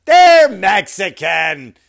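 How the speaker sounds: loud, very slow insistent shout; emphatic shout. Acted. Comic insisting to the audience the kids are Mexican, shouted. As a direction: drag out the words and shout them. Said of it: "you'll find they're from Ecuador".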